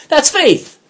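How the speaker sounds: joyful laughing exclamation. Real, not acted. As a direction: break into a delighted laugh on it.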